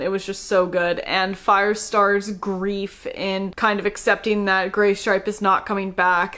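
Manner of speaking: ordinary talk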